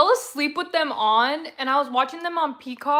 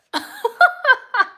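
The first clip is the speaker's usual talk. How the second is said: high, loud burst of laughs; real laughter; 11 semitones higher, 7 dB louder than usual. Real, not acted. Streamer cracking up at her own chip story, real laughter.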